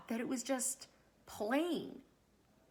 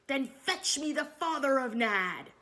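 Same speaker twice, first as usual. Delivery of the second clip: louder, higher royal command; 4 semitones higher, 6 dB louder than usual. A narrator's reading. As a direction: order it like an impatient king. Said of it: "commanded the king".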